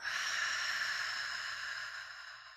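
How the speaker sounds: forceful unvoiced exhale scream; whisper scream. Shown as a demonstration. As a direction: fog a mirror with a breathy scream. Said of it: "Like you're fogging up a window".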